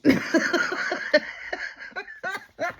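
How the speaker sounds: high, loud wheezing cackle; big laugh. Real, not acted. Storyteller cracking up at the man's joke, loud cackle. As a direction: burst out laughing at the punchline.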